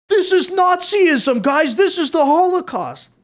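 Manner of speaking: high, slow, hammed-up mock horror; mock outrage. Commentator parodying critics, slow high mock outrage. Sarcastically voicing critics' overreaction.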